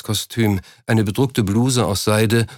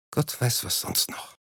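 lowered voice; 10 dB quieter than usual. A narrator's reading. Wife trailing off about his health, whispering. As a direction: voice sinking to a whisper. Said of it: "senkte sie die Stimme".

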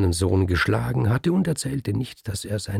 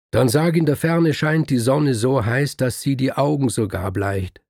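higher and much louder, declaiming; emphatic coaching; 5 semitones higher, 5 dB louder than usual. Acted. The man answering the scribe's doubt, loud and emphatic. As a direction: announce it boldly.

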